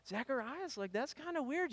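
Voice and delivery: puzzled crowd. Retold or imitated. The crowd puzzling over mute Zacharias, hushed and curious. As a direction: murmur it like gossip.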